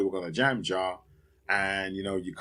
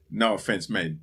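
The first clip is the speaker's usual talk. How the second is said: ordinary delivery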